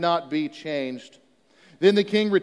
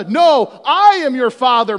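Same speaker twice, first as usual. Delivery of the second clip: loud, higher movie-villain reveal; Darth Vader quote; 4 semitones higher, 9 dB louder than usual. Retold or imitated. Preacher quoting the film's big reveal, loud. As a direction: boom out the famous reveal line.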